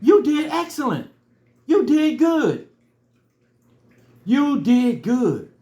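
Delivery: high, loud and slowing, full of praise; a stranger praising a crying runner, loud and high; encouraging praise. Retold or imitated. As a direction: cheer him on like a proud stranger. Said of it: "He said".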